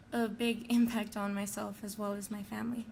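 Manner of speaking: ordinary delivery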